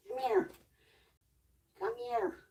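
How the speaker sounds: very high sweet call to the cat; pet call. Real, not acted. Luring the cat over, very high sweet pet call. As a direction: sing it up high to lure her.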